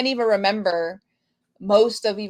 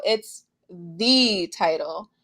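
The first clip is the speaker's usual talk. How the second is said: "the" drawn out long as "thee" before "title"; slow right down on a stretched "the"; single out "the" as the one that matters; stress on "the"; 3 semitones lower, slower than usual. Shown as a demonstration.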